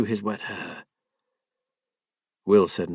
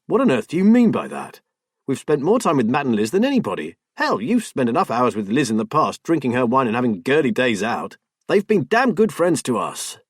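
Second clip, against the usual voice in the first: angry outburst; 3 semitones higher, 4 dB louder than usual. Acted. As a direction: hit back at her, loud. Husband finds voice, confronts wife.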